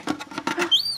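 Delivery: quick, breathy gasp of suspense; suspenseful gasp; sharp gasp. Real, not acted. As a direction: gasp as the pet leaps. Pet nearly reaches food.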